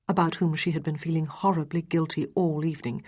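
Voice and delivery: plain reading voice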